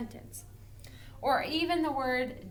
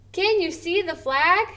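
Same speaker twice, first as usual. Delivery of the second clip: lively question; 8 semitones higher, 7 dB louder than usual. Shown as a demonstration. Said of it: "this should be read".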